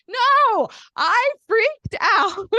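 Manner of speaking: very high, loud, slow frantic cry; exasperated yell. Retold or imitated. Mom correcting her son in a high frantic shout. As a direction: shriek it out, drawn and flustered. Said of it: "I was like".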